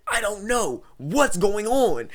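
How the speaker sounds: high, loud, bewildered yell; acted-out frantic confusion; panicked shouting. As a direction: holler it back, drawing out the question. Panicked reply in retold fire scene.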